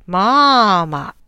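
マーマ with the first vowel held long and level; drawn-out tone. Shown as a demonstration. Demonstrating Chinese tones to viewers, a slow drawn-out マーマ. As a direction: stretch out the マー in マーマ slowly. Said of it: "マーマというのと".

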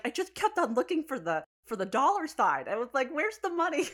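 high, loud, baffled retelling; mock bewilderment, pitch climbing; acted confusion. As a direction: protest like you missed the prize. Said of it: "He was so confused".

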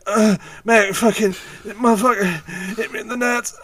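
high, strained groan of a guy hit in the nuts; hurt wrestler. Retold or imitated. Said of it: "I just".

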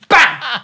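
high, loud gunshot yell; shouted bang. Pretend. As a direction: scream bang like a shot. Mock gunshot.

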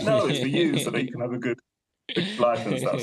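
a hint of a stern dad correcting his child; insistent dad. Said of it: "He's like".

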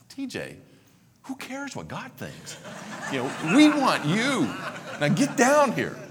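a hint of a pushy church leader; loudly urging, laughing through it; mock pushy church leader. Preacher voices church leaders' invitation.

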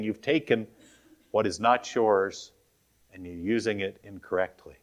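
ordinary talk, nothing marked

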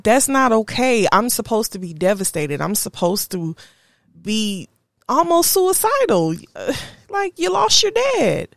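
builds from exasperated to a high, loud peak; starts exasperated and ends in a high, loud cry of disbelief; rising disbelief. Retold or imitated. Retelling her own disbelief, rising.

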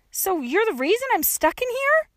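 put-on high, indignant young girl's voice; accusing. A narrator's reading. Trapped girl blames the gravedigger, high and accusing. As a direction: accuse her in disbelief.

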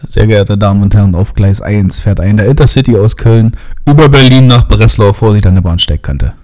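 loud, formal platform announcement; station announcement. Shown as a demonstration. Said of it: "machst du jetzt bitte eine Ansage?".

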